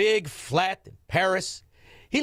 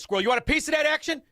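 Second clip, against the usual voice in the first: hustler pitch; 11 semitones higher, faster than usual. Acted.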